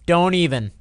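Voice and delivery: usual speaking voice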